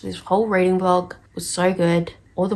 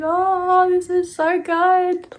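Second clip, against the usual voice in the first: high, drawn-out gushing; gushing reaction; 11 semitones higher, 3 dB louder, slower than usual. Retold or imitated. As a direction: swoon over it in a high, slow voice. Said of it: "made me go like".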